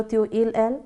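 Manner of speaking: ordinary talk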